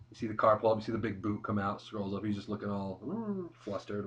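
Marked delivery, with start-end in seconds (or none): 3.1-4.1 high, slow flustered mumble. Retold or imitated. Acting out Nash looking flustered.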